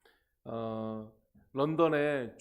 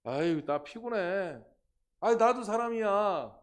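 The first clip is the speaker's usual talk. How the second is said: 피곤에 지친 사람의 푸념을 흉내 내기; 지친 하소연; 6 semitones higher, 6 dB louder, slower than usual. Acted. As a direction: act out a weary complaint, rising louder and higher. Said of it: "정말 지쳐 쓰러질". (지쳐서 투덜대는 연기)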